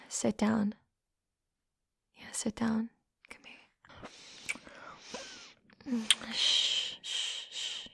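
normal talking voice

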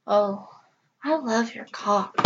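ordinary delivery

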